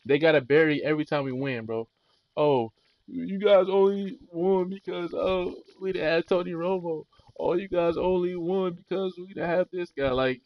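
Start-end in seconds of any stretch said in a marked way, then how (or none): 2.4-10.1 mocking whine. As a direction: sneer it like a rival fan. Mocking rival fans' excuses.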